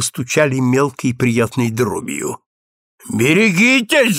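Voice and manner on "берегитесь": cried warning. A narrator's reading. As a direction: call out the warning in alarm. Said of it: "закричал супруг". (предостерегающий выкрик)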